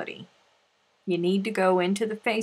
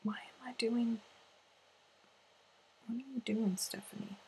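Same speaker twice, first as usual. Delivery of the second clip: breathy unvoiced whisper, much softer; 4 semitones higher, 10 dB quieter than usual. Real, not acted. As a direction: whisper it like a secret aside. Teasing whisper to a viewer.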